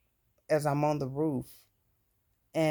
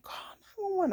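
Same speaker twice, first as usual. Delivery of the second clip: mock scream, pitched way up; retold scream. Screaming from the roof for someone to call 911, very high. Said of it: "So as I'm screaming".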